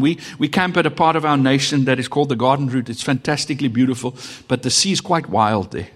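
ordinary talk, nothing marked

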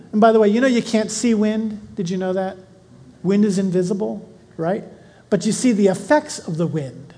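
usual speaking voice

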